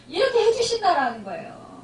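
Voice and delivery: usual speaking voice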